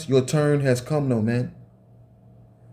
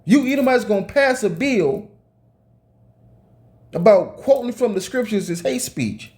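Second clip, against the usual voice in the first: higher, angry preaching tirade; heated rant; 6 semitones higher than usual. Real, not acted. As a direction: rail at them, pitch climbing. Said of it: "Let me calm down".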